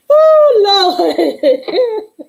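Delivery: high, loud "Oh" breaking into laughter; real helpless laugh on the words; laughing exclamation. Real, not acted. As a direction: cry out, then crack up. Bursts out laughing after the story.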